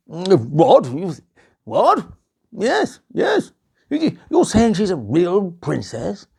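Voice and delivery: a hint of a puzzled king; lightly voiced king asking again. King questioning the queen, lightly puzzled.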